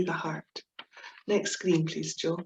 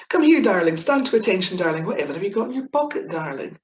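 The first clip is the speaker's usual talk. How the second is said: loud barking officer, fast; mock officer; 5 dB louder, faster than usual. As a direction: bellow like an army officer. Quoting Blackadder Captain Darling lines.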